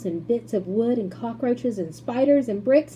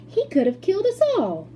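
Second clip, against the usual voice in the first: put-on outraged old woman; 12 semitones higher, 5 dB louder than usual. A narrator's reading. Grandma Josephine outraged.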